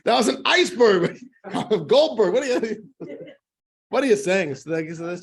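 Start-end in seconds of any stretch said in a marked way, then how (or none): 0.1-1.9 laughing while saying it, higher and louder. Real, not acted. Teacher laughing at his own joke to class, higher and louder. 1.9-4.6 higher, louder indignant retort. Acted. Goldberg answers the businessman's slur, indignant and louder.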